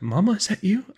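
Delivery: very high, cracked plea; loud, high-pitched hopeful question; voice cracking. A narrator's reading. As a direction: let the voice crack upward. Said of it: "My voice cracked".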